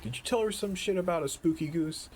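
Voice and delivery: hammed-up irritated ex; imitating annoyed mom. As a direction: squeak it out like an exasperated mother. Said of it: "her mom called me and was like".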